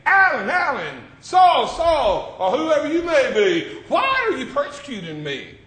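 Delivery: high loud shout calling the name; heavenly call. Acted. Preacher voicing heaven calling a listener by name, shouted.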